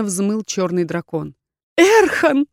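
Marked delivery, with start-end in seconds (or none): 1.8-2.5 high, loud call of despair; anguished cry. A narrator's reading. Heroine calling after departing Erkhan, anguished. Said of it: "слёзы заглушили мой крик".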